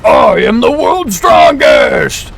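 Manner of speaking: high, very slow, shouted robot villain; Dr. Wheelo voice. Acted. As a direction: boom it through a robot modulator. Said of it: "my robot voice modulator to kick in".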